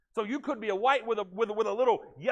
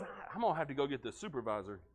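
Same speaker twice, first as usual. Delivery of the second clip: lower, softer, resigned angel; 8 semitones lower, 8 dB quieter than usual. Retold or imitated. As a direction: mumble it like a tired clerk.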